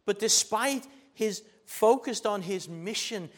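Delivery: loud, high, driving sermon voice; preaching at full force. Acted. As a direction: preach it out to the congregation.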